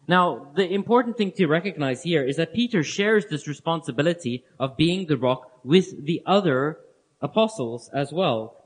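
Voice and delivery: ordinary delivery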